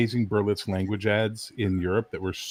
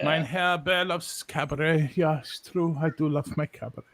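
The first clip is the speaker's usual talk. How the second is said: mock German accent; 3 semitones higher, 4 dB quieter than usual. Mock German character bit.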